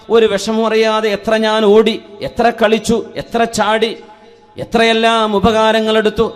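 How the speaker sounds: loud, ringing sermon delivery; impassioned preaching. Real, not acted. Preacher urging listeners to value their bodies, impassioned. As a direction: preach it out to a big congregation. Preaches at full force to congregation.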